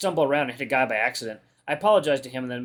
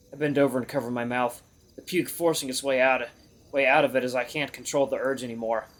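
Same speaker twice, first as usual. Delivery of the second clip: ordinary delivery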